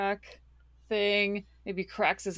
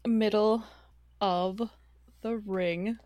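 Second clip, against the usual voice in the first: normal talking voice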